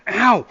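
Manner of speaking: sudden sharp ow; real yelp of pain. Real, not acted. Pinches finger on tight lid.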